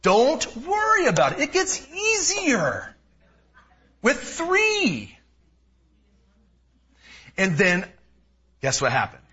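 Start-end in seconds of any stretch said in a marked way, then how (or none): 0.0-5.2 mock reassuring friends. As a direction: ham up the cheery advice. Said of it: "what everybody said".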